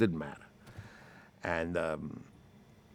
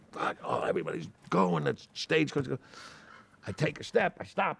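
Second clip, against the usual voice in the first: normal talking voice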